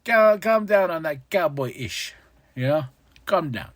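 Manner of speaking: hammed-up calm-down; mock calming interviewer. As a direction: settle the fighters down. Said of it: "Renee's like".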